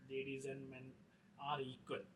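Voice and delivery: ordinary delivery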